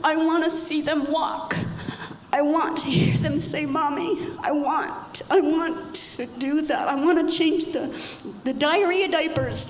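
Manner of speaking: passionate protest. Retold or imitated. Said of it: "I'm like".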